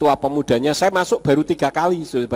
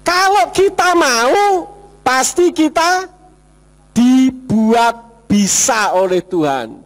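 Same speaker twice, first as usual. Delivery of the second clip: seruan khotbah; 6 semitones higher, 4 dB louder, slower than usual. Real, not acted. Klimaks khotbah yang berseru.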